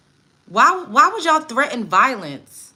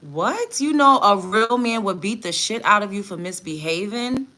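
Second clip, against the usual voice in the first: normal talking voice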